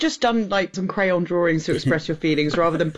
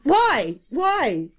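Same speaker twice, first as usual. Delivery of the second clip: mock exasperated rant; 3 semitones higher, much slower than usual. Exasperated rant about the film.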